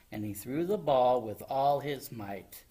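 usual speaking voice

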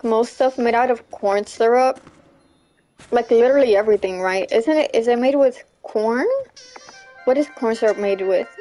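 normal talking voice

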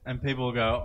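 usual speaking voice

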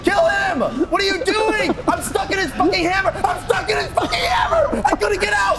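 real panicked gamer yelling; yelling under attack; frantic shouting. Real, not acted. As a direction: shout it in a frantic, rising fluster. Panicked yelling in boss fight.

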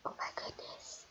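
slow, breath-only whisper; hushed whisper. Acted. Reacting to the reunion in the cafe, slow whisper. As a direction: whisper it in astonishment.

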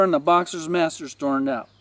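ordinary delivery

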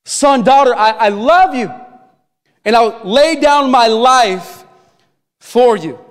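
slow, loving promise over the worship team; Jesus promising his life, slow and tender; voicing Jesus again. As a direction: draw it out slowly, with feeling. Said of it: "word of Jesus who says".

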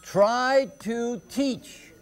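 "Try to teach" drawn out very slowly, word by word; anapest scansion. Shown as a demonstration. Teacher scanning the second line, slow measured "Try to teach". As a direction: scan "Try to teach" slowly as light, light, heavy. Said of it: "We have light, light, heavy".

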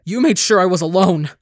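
loud accusing shout; anguished angry scream. A narrator's reading. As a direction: scream the accusation back at her. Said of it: "Ranbu screams".

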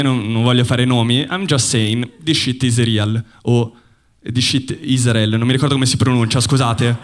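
ordinary delivery

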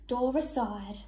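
usual speaking voice

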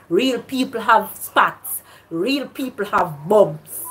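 deliberate, word by word; slow and emphatic. Real, not acted. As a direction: spell it out slowly, punching each phrase.

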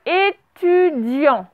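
drawn out syllable by syllable; teacher's careful, stretched-out dictation; slow dictation. Shown as a demonstration. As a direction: dictate it very slowly to a class, stretching each syllable. Dictating a word to pupils.

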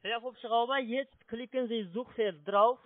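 ordinary delivery

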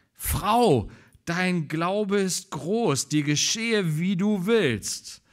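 slow solemn voice of Jesus. Acted. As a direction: grant her wish with authority. Jesus answers the woman.

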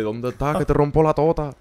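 playful Latin reggaetón accent impression; Latin street accent. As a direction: toss it off in a caricatured Latin urban accent.

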